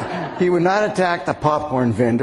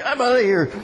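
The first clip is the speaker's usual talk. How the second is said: mock scared; 7 semitones higher than usual. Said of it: "running scared for their lives".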